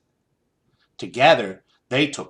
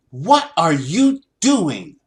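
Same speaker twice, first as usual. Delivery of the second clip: sharp, slow, louder demand; a sharp voice calling out from the shop; 5 semitones higher, 5 dB louder, slower than usual. A narrator's reading. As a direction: snap the question out sharply. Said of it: "a sharp voice rang out".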